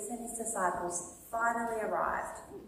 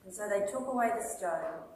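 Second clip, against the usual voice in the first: ordinary delivery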